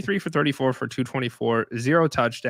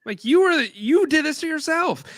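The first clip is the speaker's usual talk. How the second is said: heated rant; 10 semitones higher, 4 dB louder than usual. Real, not acted. Host scolding the ejected linebacker, heated. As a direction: pitch way up, scolding the player.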